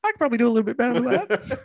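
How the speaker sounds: very high, quick squeaky impression; comic high-voiced manager, laughing; Jimmy Hart. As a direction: pitch it way up and rattle it off. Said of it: "Jimmy Hart probably went".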